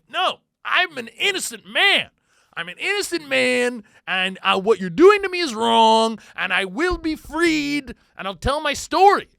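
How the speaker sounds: high, loud hammed-up grand protest; mock innocent prisoner. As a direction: play the wronged hero with mock dignity. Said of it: "Nelson Mandela in my own house".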